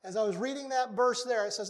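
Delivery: ordinary delivery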